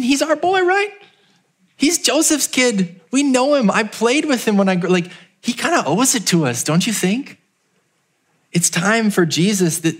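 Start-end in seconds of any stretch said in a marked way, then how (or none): 0.0-7.4 a higher, hammed-up proud neighbor voice; mock hometown crowd. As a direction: mimic the smug crowd claiming their boy. Voicing possessive hometown crowd.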